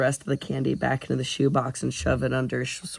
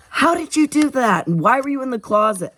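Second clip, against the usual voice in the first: excited; 11 semitones higher, 5 dB louder than usual. A narrator's reading. Bursting in, demanding answers.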